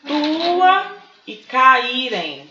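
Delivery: very slow "Tua e caírem", each vowel sounded apart; vowels in separate syllables. Shown as a demonstration. Teacher repeating the poem's examples, "Tua e caírem" drawn out vowel by vowel. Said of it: "pronunciados em sílabas diferentes".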